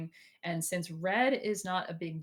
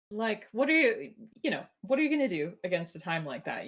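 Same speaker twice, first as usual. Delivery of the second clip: normal talking voice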